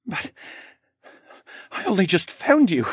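high, halting, upset voice; halt, then push the words past a sob; choked-back sob. Acted. Son to his dying mother, choking back a sob. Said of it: "choked back another sob".

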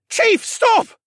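high, loud urgent shout; barked order. A narrator's reading. Comrade orders the chief to stop killing, barking. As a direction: bark at him to stop. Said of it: "someone barked".

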